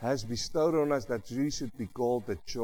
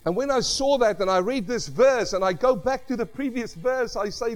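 louder, rising preacher's delivery, pushing to the congregation; full-force preaching; 8 semitones higher, 6 dB louder than usual. Acted. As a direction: preach it out with conviction to the brothers and sisters. Preaching to congregation about love.

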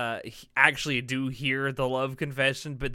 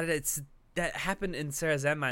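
normal talking voice

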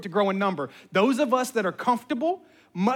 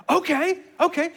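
a hint of a high child voice; agreeable little kid; 9 semitones higher than usual. Imitating daughter agreeing to mom's plan, lightly voiced childlike assent.

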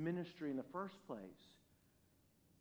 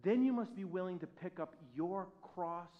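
ordinary delivery

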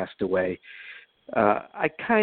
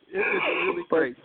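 laughing; 6 semitones higher than usual. Real, not acted. Guest delighted by the pilot anecdote, laughing. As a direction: laugh that rolls into happy words.

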